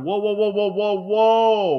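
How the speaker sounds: high, loud run of whoas; acted alarmed objection. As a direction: call a halt, rising to a shout. Acting out objection to bad behavior.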